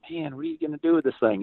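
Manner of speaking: high, fast mock disbelief; a kid thinking about a rotting DeLorean, baffled; incredulous thought. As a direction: wonder aloud at the waste. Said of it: "I just thought".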